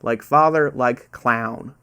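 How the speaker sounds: light put-on American accent, clown said with an American "ow" as in "cow"; half-hearted American accent from a non-American quiz host; attempted American accent. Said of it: "my attempt at an American accent".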